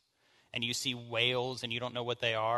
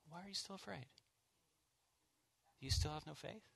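soft, tender and much quieter; gentle; 4 semitones higher, 10 dB quieter, faster than usual. Shown as a demonstration. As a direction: ask it gently, like a caring friend. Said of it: "in his gentleness".

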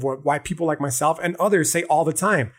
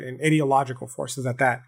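ordinary delivery

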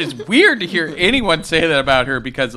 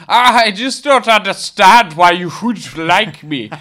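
Heinrich impression; 7 semitones higher, 8 dB louder than usual. Podcast host mocking the dancing-drunk Heinrich, hammed-up accent. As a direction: play the swaying eccentric with a thick foreign lilt.

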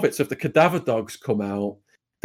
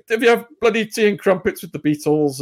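higher-pitched mock British accent; fake British accent; 6 semitones higher than usual. Mafia man putting a nervous inmate at ease, fake British accent. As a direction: put on a jokey British accent. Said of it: "in a fake British accent".

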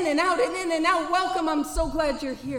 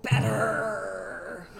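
choked-up admission; 5 semitones lower, 4 dB quieter, much slower than usual. Real, not acted. Sobriety brings no relief.

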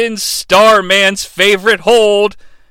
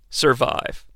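ordinary talk

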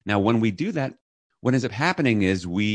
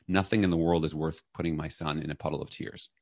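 ordinary talk, nothing marked

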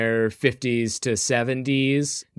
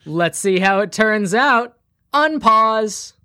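hyped announcer; 10 semitones higher, 7 dB louder, slower than usual. Acted.

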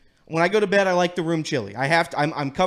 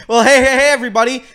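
loud hammy weatherman hello; excited greeting; 5 semitones higher, 9 dB louder, faster than usual. Acted. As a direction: burst in like a TV host. Weatherman bit opening greeting.

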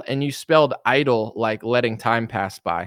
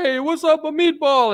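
hammed-up Italian accent, much higher; big mock Italian accent, pitched way up; Italian guy. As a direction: play a cartoon Italian greeter. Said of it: "like, an Italian guy".

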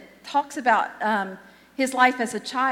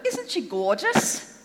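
put-on terrible Australian accent, higher and drawn out; Steve Irwin; 5 semitones higher than usual. Crocodile hunter admiring an ugly crocodile, enthusiastic bad Australian accent. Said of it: "I do a terrible Australian accent".

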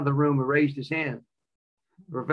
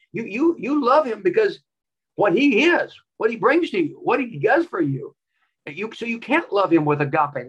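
preaching; 6 semitones higher, 3 dB louder than usual. Real, not acted. Preaching at rising force.

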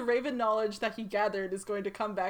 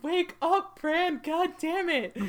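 high, loud impatient call, slowing; exasperated yell; 8 semitones higher, 4 dB louder, much slower than usual. Retold or imitated. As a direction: yell it out, fed up. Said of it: "Jojen and Amir were like".

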